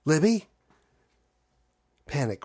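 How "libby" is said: panicked call. Acted.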